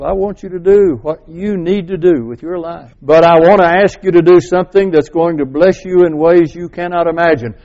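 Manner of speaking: ordinary talk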